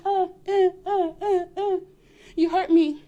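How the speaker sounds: high mock sobs; fake crying. Woman faking tears to cheating man, mock sobs. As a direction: pretend to sob for him. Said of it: "You cry".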